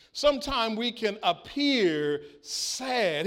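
ordinary talk

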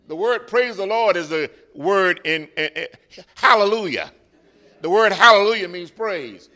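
loud, high preaching cadence; fervent pulpit preaching; preaching at full force. Acted. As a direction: preach it, rising.

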